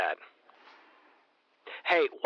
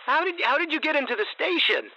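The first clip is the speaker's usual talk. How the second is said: alarmed anger; 10 semitones higher, faster than usual. Acted. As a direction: confront an intruder, voice up high. Caller finds stranger at station.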